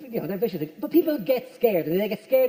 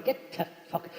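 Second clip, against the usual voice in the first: weary, hushed and breathy, much softer; exasperated; 5 semitones lower, 8 dB quieter, slower than usual. Shown as a demonstration. Comic showing the exasperated way to say it to the burglar. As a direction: shoo it off wearily like a fed-up sigh. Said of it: "It's more the exasperated tone".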